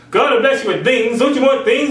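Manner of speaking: high, sales-pitch preacher voice; high mocking preacher pitch; mock prosperity preacher.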